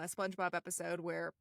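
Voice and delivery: ordinary talk, nothing marked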